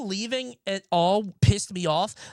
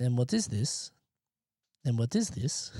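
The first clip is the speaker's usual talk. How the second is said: Borat impression; 5 semitones lower, 5 dB quieter than usual. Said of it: "deleted Borat scene with the cheese".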